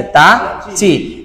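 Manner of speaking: তা, চি drawn out very slowly and separately; slow syllable drill. Shown as a demonstration. Teacher to students, very slow তা চি. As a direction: stretch out তা and চি for the class. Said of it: "সেটা হচ্ছে শুধু চি".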